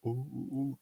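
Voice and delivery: small, hushed mock "ooh" noises; imitated oohs. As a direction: mimic a string of quiet oohs. Imitating the character's reaction noise.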